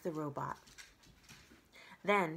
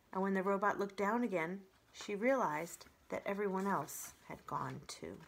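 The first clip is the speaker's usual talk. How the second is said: ordinary delivery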